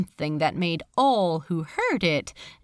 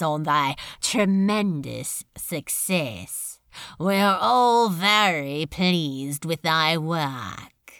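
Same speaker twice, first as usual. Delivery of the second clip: low, slow demon lord, coldly pleased; Beelzebub, smooth menace; 4 semitones lower, slower than usual. Acted. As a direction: purr the praise like a prince of hell. Beelzebub voiced in the story.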